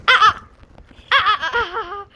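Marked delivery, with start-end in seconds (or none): none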